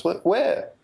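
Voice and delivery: high, startled questioning; mock surprise. Friend caught off guard by a stranger, surprised and high. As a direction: pitch the question up in alarm.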